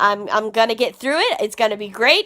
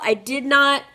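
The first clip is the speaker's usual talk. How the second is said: usual speaking voice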